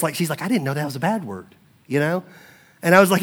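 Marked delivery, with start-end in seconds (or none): 0.4-1.5 put-on small, soft voice of a child owning up; softly retold embarrassment; almost embarrassed. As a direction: quietly imitate a sheepish little girl. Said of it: "she was almost embarrassed".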